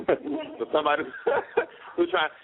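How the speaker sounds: laughing. Real, not acted. Laughing at caller's question.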